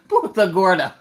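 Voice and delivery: said through real laughter; laughing. Real, not acted. Joking about Florida cities, laughing hard. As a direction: crack up while naming it. Cracking up at a city name.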